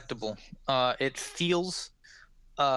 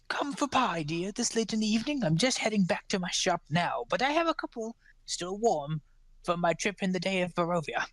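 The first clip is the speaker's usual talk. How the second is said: put-on high, quick old woman's voice, all grandmotherly charm; sweet old pie seller; 6 semitones higher than usual. Old woman offers pies to a traveller on the road, high sweet voice. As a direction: coax the stranger in like a kindly granny, pitched up.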